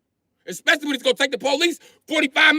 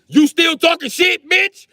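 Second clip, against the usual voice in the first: slow, loud, raised challenge; retold shout; 6 semitones higher, 5 dB louder, slower than usual. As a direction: bark it out at the driver, slow and high. Retold confrontation at traffic light.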